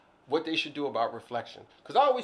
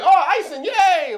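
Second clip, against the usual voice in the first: put-on high, loud childlike squeal of joy; gleeful squeal; 15 dB louder, slower than usual. Retelling seeing birthday cake icing, a loud high squeal. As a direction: squeal like a kid spotting icing.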